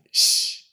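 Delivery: long unvoiced sh hiss; sh sound. Shown as a demonstration. Teacher showing the Argentine sh sound. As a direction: hiss a steady sh. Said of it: "It's with this sound".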